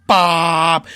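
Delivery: sudden loud bark of a name; yelling for attention mid-meltdown; shout. Retold or imitated. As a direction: call it out sharply over the noise. Yelling over a noisy kitchen.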